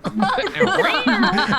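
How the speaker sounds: very high, loud fairy laugh; fairy cackling at her own joke; fairy cackle. Acted.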